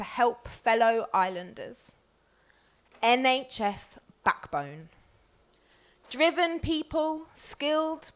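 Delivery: deliberate recital, word by word; poem reading. Acted. Poet reads an absent friend's poem to the room, deliberate recital. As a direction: read each word as its own line.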